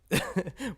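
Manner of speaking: loud rough belly laugh; booming hoarse laugh; hoarse Kool-Aid man laugh. Real, not acted. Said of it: "like the Kool-Aid man with the hoarse throat".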